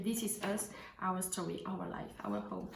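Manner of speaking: English read with a heavy French accent; clumsy French-accented English, quiet; bad English accent. Real, not acted. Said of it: "J'ai un accent pourri, un accent anglais pourri".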